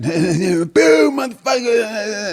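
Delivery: high, drawn-out jeer; heckling boo. Retold or imitated. Heckler lighting a cigarette, high drawn-out jeer.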